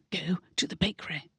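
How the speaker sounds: hushed unvoiced whisper; a quick, breathless whisper. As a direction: whisper it urgently so no one hears. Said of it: "Collinsworth whispered".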